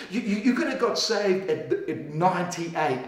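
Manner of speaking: ordinary talk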